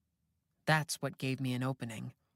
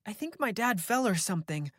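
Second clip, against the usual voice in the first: worried daughter; 7 semitones higher than usual. A narrator's reading. Beth worried, not into phone.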